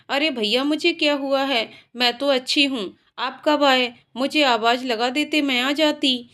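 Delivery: ordinary delivery, nothing marked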